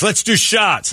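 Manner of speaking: put-on high excited drunk call; drunk guy voice. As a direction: push the party on him like a drunk. Drunk man voiced in story.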